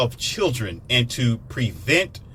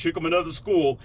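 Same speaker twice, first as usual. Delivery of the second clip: normal talking voice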